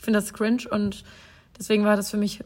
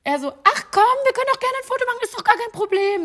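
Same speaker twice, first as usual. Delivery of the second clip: much higher, gushing mock-fangirl tone; 12 semitones higher than usual. Mocking how the celebrity teased her, high fangirl voice. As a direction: play him up as a giddy fangirl. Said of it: "So Fangirl-mäßig".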